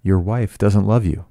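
usual speaking voice

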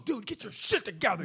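mock angry cop. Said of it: "He got mad".

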